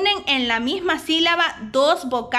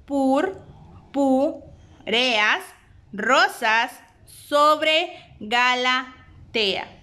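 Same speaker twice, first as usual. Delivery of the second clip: "Purpureas rosas sobre Galatea" very slow, syllable by syllable; slow scansion; much slower than usual. Shown as a demonstration. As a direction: scan the verse slowly, beat by beat. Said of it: "dividir las sílabas de la siguiente manera". (escandiendo el verso muy despacio)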